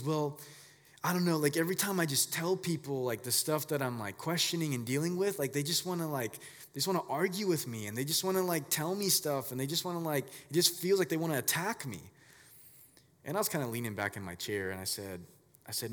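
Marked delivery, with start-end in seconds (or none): 0.0-12.0 put-on higher, louder, anxious young voice; a nervous teen unloading his hurt; upset teenager. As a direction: pour it out like a scared kid.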